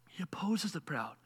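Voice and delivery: soft whispered emphasis; hushed whisper. Real, not acted. Preacher whispering the verse to the congregation. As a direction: breathe the words for weight.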